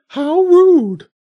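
very high, loud squawking bird, indignant; offended bird voice. A narrator's reading. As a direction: squawk it like a huffy parrot. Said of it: "the bishop's voice came through".

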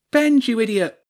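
high, loud scolding shout; angry shout. A narrator's reading. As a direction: yell up at the culprit from below. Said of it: "she shouted up at him".

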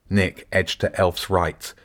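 plain reading voice

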